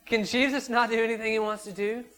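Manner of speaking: high, loud joyful shout; excited preaching call. Real, not acted. Preacher rallying the church for a loud response. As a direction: rouse the congregation with a laughing shout.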